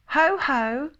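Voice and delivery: high, hearty ho-ho; bold, high-pitched storybook call; loud and sudden. A narrator's reading. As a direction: burst out with it suddenly. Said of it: "loudly and suddenly".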